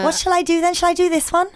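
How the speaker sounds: high-pitched, wobbly stab at Geordie; dodgy Geordie-cum-Scouse accent; Geordie accent attempt. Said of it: "Is that Geordie?".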